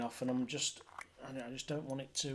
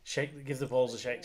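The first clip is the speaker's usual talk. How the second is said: ordinary talk, nothing marked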